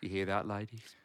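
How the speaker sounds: hammed-up British charmer; British accent. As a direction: play up the English accent for the ladies. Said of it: "your sexy British accent".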